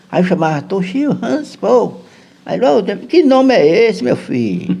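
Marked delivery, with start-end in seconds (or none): 2.6-4.8 drawn-out motherly disbelief; puzzled mother. Retold or imitated. Mother hears strange names.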